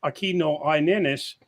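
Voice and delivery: put-on Spanish with a slow American accent. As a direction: read the Spanish slowly, anglicized. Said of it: "pardon my Spanish pronunciation".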